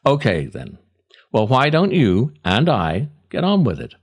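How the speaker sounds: ordinary talk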